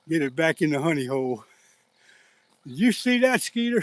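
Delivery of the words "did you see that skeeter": high, loud, thrilled call to a friend; excited call to Skeeter after the big catch. Real, not acted. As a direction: shout it out, still buzzing from the catch. Thrilled after landing big fish.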